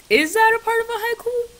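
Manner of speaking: high squeaky put-on voice; silly high-pitched question; weird voice. Shown as a demonstration. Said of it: "I said that so weird".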